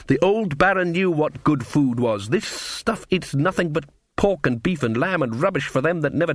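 lightly put-on rustic voice, scornful; indignant country cook; disgruntled cook figure. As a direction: grumble about proper food in character. Mrs Pleasant's grumbling speech.

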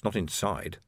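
plain reading voice

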